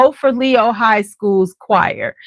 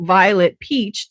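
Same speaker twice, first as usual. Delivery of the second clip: ordinary talk, nothing marked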